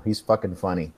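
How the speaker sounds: ordinary delivery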